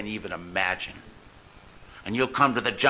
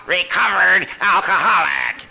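mocking old-timer; 7 semitones higher, 5 dB louder than usual. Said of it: "one of those old-timers who said".

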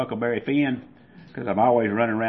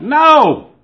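high, loud desperate shout; child's yell; 13 dB louder than usual. Retold or imitated. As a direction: scream it to stop the father. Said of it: "and I yelled out".

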